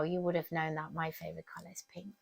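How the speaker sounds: hushed, playful confiding; secret whisper. Acted. Teacher whispering her favourite colour to the children. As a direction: whisper it like a little secret to the kids.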